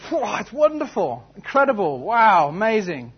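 high, loud acted awe; hammed-up wonder; gushing amazement. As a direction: rave like a dazzled spectator. Acting the Duke's gushing praise.

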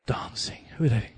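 unvoiced scornful mutter; scornful whisper. Preacher imitates critical Michal sneering at dancers. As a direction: sneer under your breath. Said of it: "I could have been like her".

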